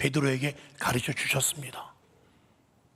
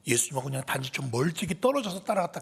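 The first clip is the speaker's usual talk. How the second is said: ordinary talk, nothing marked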